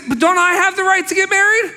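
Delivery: Paul quoted. Retold or imitated. Preacher acting Paul asking about his rights, high and loud. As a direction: push it up high as Paul's protest. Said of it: "He said".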